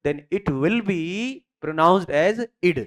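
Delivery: very slow, word by word dictation. Shown as a demonstration. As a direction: spell the rule out slowly for notes. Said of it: "you have to follow three conditions".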